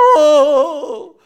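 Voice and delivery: long, loud drawn-out wail of grief; wailing aigo. Shown as a demonstration. Preacher showing how mourners wail, long loud cry. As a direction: howl it like a mourner beating the ground. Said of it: "막 이렇게 밤새워 통곡하는 겁니다".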